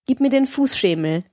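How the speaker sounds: a short, mildly imperious instruction; curt command. A narrator's reading. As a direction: order a servant about, understated. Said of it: "befahl sie kurz".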